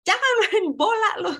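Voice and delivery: high, loud scolding shout; angry coach yell. Retold or imitated. Coach scolding the junior for stray balls, angry yell. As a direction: tell the kid off across the court.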